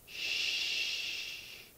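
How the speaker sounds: whispered shh; shushing. Acted. Buzz hushes the flies, whispered. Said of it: "Buzz said".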